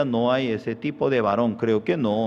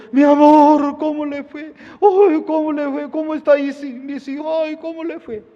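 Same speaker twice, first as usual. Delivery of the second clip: very high, loud, syrupy greeting; sweet-talking husband; 6 dB louder than usual. Acted. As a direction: gush over her like a changed man.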